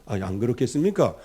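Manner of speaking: usual speaking voice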